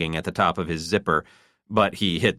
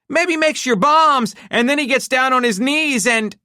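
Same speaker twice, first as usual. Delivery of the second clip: very high, loud shrieking taunt; 7 dB louder than usual. A narrator's reading. Wife shrieking at her husband, very high and loud. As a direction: scream it at him.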